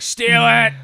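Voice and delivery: sudden loud mock yell; shouted. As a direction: bark it out gleefully. Mock voicing of a Wi-Fi thief.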